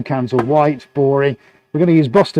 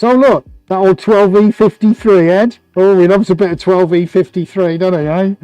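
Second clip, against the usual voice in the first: much higher, louder delight; excited; 5 semitones higher, 6 dB louder than usual. Real, not acted. Painter gushing to viewers over a favourite colour, excited.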